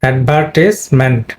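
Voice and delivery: slow, careful অ্যাডভার্টিজমেন্ট with the stress on "tis"; slow model word. Shown as a demonstration. Teacher closing the lesson on the word, slow demo. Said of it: "পরে এটা খুবই গুরুত্বপূর্ণ".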